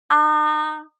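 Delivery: high level "Ah", held steady; "Ah" held high and level, no rise or fall; first tone. Shown as a demonstration. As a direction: sustain "Ah" on one high, even pitch. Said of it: "starts high and maintains the high pitch".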